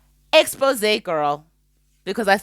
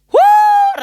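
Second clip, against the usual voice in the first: loud high whoo of approval; high whoop; 14 dB louder than usual. Real, not acted. Vlogger hyping preaching, loud high whoop. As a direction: whoop like the choir's cheering.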